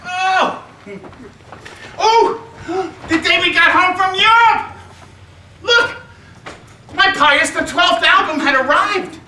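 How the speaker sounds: put-on high, excited child squeals; boy shouting to Nana about his Pope album, thrilled. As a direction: burst with joy over the parcel.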